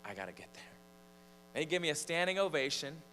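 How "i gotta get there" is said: low, soft, apologetic retelling; hushed, lowered voice for a reluctant exit; subdued apology. Retold or imitated. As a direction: apologize quietly to the crowd. Retold apology to congregation.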